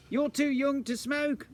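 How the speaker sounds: high, slow comic scolding; mock stern parents. The Jolly parents scold the little chimney, high and slow.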